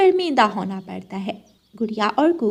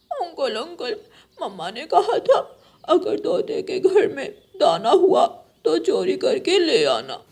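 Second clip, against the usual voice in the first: higher child voice, a light mock whimper; lightly played hurt little boy; high whiny little crow; 4 semitones higher than usual. Injured Jaggu tells uncle his wing hurts, high and whiny.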